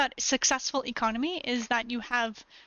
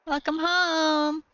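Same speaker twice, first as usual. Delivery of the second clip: slow, higher, drawn-out croon; cooed welcome; 4 semitones higher, much slower than usual. Real, not acted. Crooning a welcome to her dog, slow and higher. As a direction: stretch out home lovingly.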